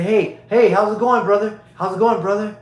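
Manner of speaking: high, loud, hearty hey brother; mock friendly greeting to a stranger on the street. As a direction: greet a stranger like an old pal. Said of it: "walk down the street and say".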